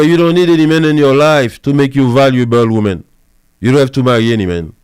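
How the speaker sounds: ordinary delivery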